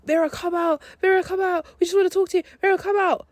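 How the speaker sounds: high, insistent mock calling through a door; imitating guys calling out. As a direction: put on a high-pitched voice, calling her out.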